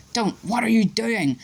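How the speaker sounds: hissed. A narrator's reading. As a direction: sibilo urgente e teso. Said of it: "Harry hissed".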